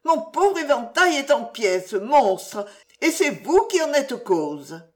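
high-pitched, indignant scolding; heated feminine outburst, pitched well up; furious reproach. A narrator's reading. Widow blaming him for her broken fan, furious reproach. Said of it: "avec une explosion soudaine de fureur".

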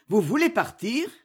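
usual speaking voice

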